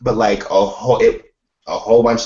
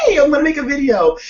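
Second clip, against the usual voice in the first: very high, fast gleeful cheer; high-pitched mock excitement; excited squeal; 3 dB louder, faster than usual. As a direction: squeal it like a thrilled kid. Excited quote of own past self.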